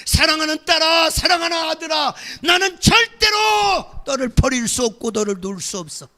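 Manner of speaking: preaching at full force. Acted. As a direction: shout God's refusal to let go. Voices God's plea.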